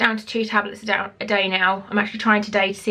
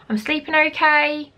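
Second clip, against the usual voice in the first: ordinary delivery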